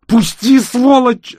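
put-on high, loud girl's shriek; desperate scream. A narrator's reading. Girl struggles in rescuer's grip, screaming desperately. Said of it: "отчаянно закричала она".

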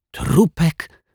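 high, startled echo of a word; strangled, incredulous query; choked-out disbelief. A narrator's reading. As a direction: blurt it as a high-pitched shocked question. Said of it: "wykrztusił detektyw pozytywka".

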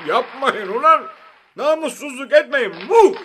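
high, loud protest opened by a laugh; helpless angry laughing. A narrator's reading. Prime minister at wits' end with applauders, laughing helplessly. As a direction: laugh in despair as you beg them to stop. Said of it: "ne yapacağını şaşırıp gülerek".

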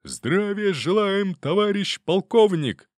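slow, high, drawn-out soldiers' bark; thunderous barked reply. A narrator's reading. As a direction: roar it out like a whole regiment. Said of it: "громогласно, отрывисто рявкнул".

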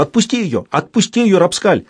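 high shouting plea; woman shouting. Acted. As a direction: shout at him to let go. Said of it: "какая-то женщина закричала".

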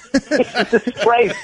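He's a disgrace, slow and laughing; slow laughing repeat. Real, not acted. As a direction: drag it out through a laugh. Repeats punchline slower.

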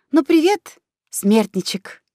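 a hint of a tender but sinister greeting; sweetly menacing. A narrator's reading. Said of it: "ласково сказала ему на ухо". (ласково-зловеще)

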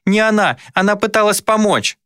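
put-on very high, urgent boy's defence; frantic high-pitched denial; child's protest. A narrator's reading. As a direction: insist desperately like a small boy. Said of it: "запротестовал я".

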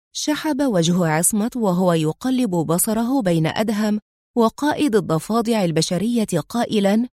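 usual speaking voice

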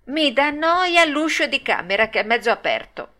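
high, fussy puppet voice; whiny excuse. Acted. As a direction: find fault like a spoilt child. Pinocchio invents another excuse.

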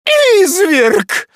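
very high, loud shriek of outrage; shrill-pitched outraged exclamation; outraged cry. A narrator's reading. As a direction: cry out in disgust. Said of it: "воскликнула миссис Нупкинс".

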